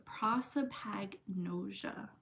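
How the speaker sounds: Prosopagnosia said slowly in a whisper, syllable by syllable; careful pronunciation. Shown as a demonstration. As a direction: sound out Prosopagnosia softly and slowly. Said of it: "I had my live volume on too".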